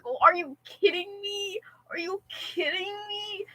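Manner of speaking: high, soft mock wail of disbelief; exasperated disbelief. Student retelling phone disaster to a friend, exasperated disbelief. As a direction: replay your dismay in a small, high voice. Retold despair over broken phone.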